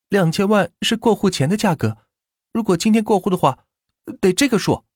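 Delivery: sly seller upping the price; shop owner voice. Acted. Shopkeeper raising price.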